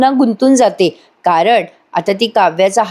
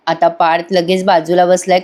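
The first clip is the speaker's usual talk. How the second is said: plain reading voice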